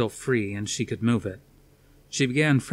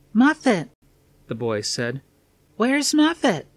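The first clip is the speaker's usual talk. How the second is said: a lightly voiced small boy, high and plaintive; six-year-old boy after the attack, lost; 12 semitones higher, 4 dB louder, slower than usual. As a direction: ask for the lost pet like a scared child. Small boy voiced asking for pet.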